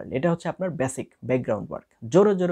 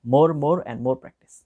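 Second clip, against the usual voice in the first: each more stretched out very slowly and a bit louder; emphatic; 5 dB louder, much slower than usual. Shown as a demonstration. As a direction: lean hard on more, more and more. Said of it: "please".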